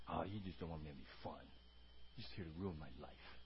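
low, soft mock grumble; hushed, put-on self-pity; sulking kid. Voices sulking child answering parent.

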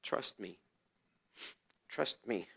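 a faint voice repeating in the mind; barely-there murmur; soft inner voice. Retold or imitated.

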